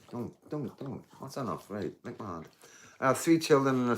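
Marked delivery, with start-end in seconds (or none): 0.1-2.5 hushed telling-off, much softer; soft scolding. Real, not acted. Streamer softly telling his dog to stop, quiet scolding.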